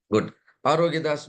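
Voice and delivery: normal talking voice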